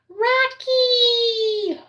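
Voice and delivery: put-on high, bright child's cry of the dog's name; kids calling out. Pre-K girls spotting the dog, imitated excited squeal. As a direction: squeal it like an excited four-year-old.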